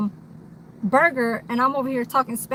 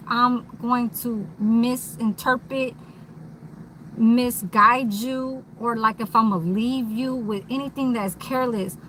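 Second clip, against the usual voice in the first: ordinary talk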